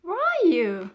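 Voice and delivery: high, loud anxious call; high loud girl calling. A narrator's reading. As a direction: call out searching for him. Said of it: "cried Florentine".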